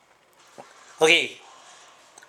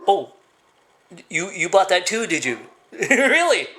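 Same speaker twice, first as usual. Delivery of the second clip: higher, teasing disbelief; mock incredulous; 5 semitones higher than usual. Mocking people who bought the line, mock incredulous. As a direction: laugh into a high "Really?". Mocks gullible believers.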